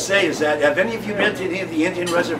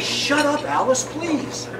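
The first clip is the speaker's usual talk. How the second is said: high, snapping shut up at his wife; a husband cutting off his nagging wife, pitched up; grumpy husband; 10 semitones higher, much slower than usual. Retold or imitated. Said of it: "He says".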